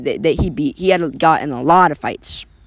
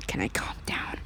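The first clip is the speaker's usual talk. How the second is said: breathy mocking whisper; 14 dB quieter than usual. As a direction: hiss it under your breath. Whispered reply in story.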